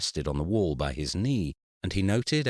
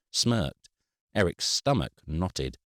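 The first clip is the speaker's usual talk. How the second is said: usual speaking voice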